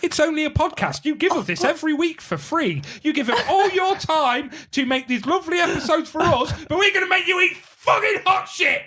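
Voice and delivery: high, hammed-up impression of a smug fan; mock listener voice. Mocking the podcast listeners who made them eat it, in a high put-on voice. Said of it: "and going".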